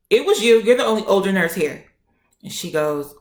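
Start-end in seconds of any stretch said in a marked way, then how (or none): 0.1-1.8 louder, higher, quickening accusation; pissed off. Retold or imitated. Patient accusing the older nurse, pissed off. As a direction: hit them hard with the accusation. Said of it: "I'm pissed. So I'm like".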